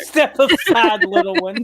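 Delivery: repeating the line while laughing; loud laughing imitation of the threat; laughing mock repeat. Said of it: "DM mocks the line laughing".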